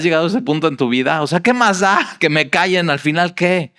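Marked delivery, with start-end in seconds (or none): none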